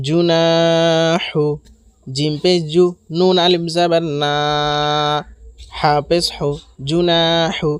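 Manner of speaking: না held long in জুনাহু, জু and হু short; elongated middle syllable. Shown as a demonstration. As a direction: stretch only the না of জুনাহু. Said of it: "নুনে টান হবে".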